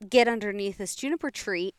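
ordinary talk, nothing marked

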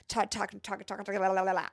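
imitating herself talking too fast, words tumbling into gibberish; mock motor-mouth gabble; rapid babbling. Nurse mimicking her rushed shift report, rapid babbling. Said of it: "I start talking real fast".

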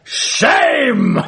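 high, shouted heckle from the back; a teacher heckling from the back of the room; booming shout. Retold or imitated. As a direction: bellow it like a heckler. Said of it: "this big booming voice comes from the back".

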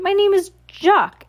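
high, slow plea to be heard; protesting cry. A narrator's reading. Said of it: "the man cried".

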